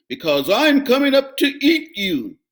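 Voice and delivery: loud troll threat; loud menacing troll; troll roar. A narrator's reading. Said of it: "roared the troll".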